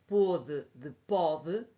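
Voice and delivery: "pôde" with closed "ô", then "pode" with open "o", slow; slow contrast. Shown as a demonstration. Teacher distinguishing two verb forms. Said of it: "para eu poder distinguir".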